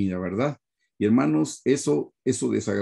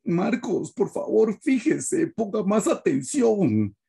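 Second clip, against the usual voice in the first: stern father; 8 semitones higher, much slower than usual. Retold or imitated. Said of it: "yo estoy como".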